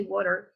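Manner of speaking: water ending in a clear er; water the American way, with its R; American water, final R sounded. Shown as a demonstration. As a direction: pronounce the R closing water. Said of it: "In American English you say".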